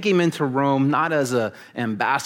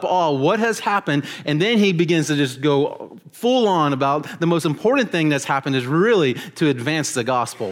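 ordinary delivery

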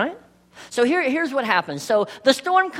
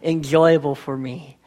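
prayer; 7 semitones lower than usual. Acted. Opening prayer before sermon.

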